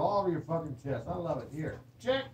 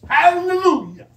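excited shout; 13 semitones higher, 15 dB louder, slower than usual. Real, not acted. Celebrating, drawn out.

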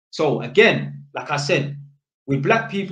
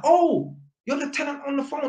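much higher, bright oh of realisation; surprised realisation; 12 semitones higher than usual. Retold or imitated. Electrician realising it's the tenant, high surprised. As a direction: let the penny drop up high.

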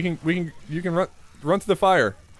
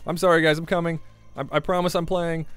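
ordinary talk

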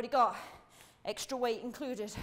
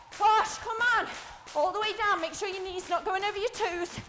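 high, loud exercise commands; urging coach shout; 5 semitones higher, 4 dB louder than usual. Real, not acted. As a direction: push them hard through the move. Coach shouting instructions mid-set.

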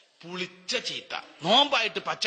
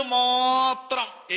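മാത്രം stretched long, louder and higher; drawn-out emphasis; 7 semitones higher, 6 dB louder, much slower than usual. Acted. Preacher to the crowd, മാത്രം drawn out loud. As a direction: hold മാത്രം long and ring it out. Preacher drives home the takbir.

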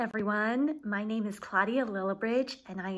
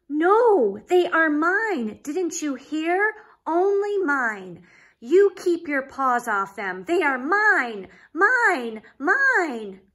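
loud, high tantrum shout; flipping out; 5 semitones higher, 7 dB louder, slower than usual. Acted. Pig screaming at Trevor to keep off his toys, tantrum. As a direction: throw a full toddler tantrum. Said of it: "Pig flipped his wig".

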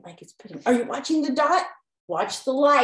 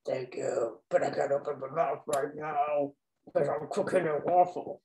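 low, talking through a full mouth; waffle maker voice; 8 semitones lower, 5 dB quieter than usual. Pretend. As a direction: voice the waffle iron, mouth stuffed. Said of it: "He's funny when he talks like that".

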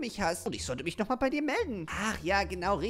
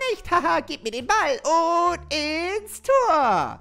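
high, loud, very slow gloating; gleeful taunt; 9 semitones higher, 10 dB louder, much slower than usual. Acted. Friend stealing the ball for a goal, loud gleeful gloat. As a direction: drag it out, showing off.

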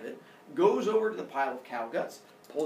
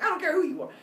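high-pitched mock yell; yelling back; 12 semitones higher, faster than usual. Apollo dismissing the mother, mock yelling. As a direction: dismiss them in a raised, squabbling voice. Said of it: "they start yelling back and forth".

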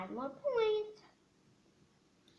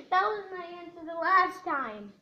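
whiny complaint; 5 semitones higher, 9 dB louder than usual. Real, not acted. Upset about a missed guess.

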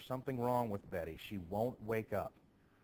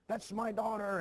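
mock outcry, much higher and louder; shocked outburst; 11 semitones higher, 6 dB louder than usual. Teacher retelling how the father spots his daughter: mock shocked outcry. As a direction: blurt it out as the startled father.

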